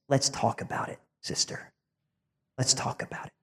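whispered, soft and intimate; gentle whisper. Acted. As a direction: lean in and whisper it like a tender invitation. Jesus speaks gently to woman.

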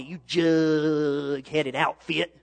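slow, drawn-out teasing aunt voice; lightly voiced fond aunt, slow; aunt's pet phrase. Aunt teasing her young nephew, slow and drawn out. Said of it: "That was her famous phrase".

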